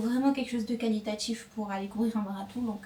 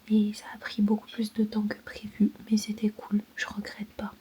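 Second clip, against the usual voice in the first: whispering; 4 dB louder than usual. Real, not acted. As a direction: whisper so nobody wakes. Whispers at night at in-laws.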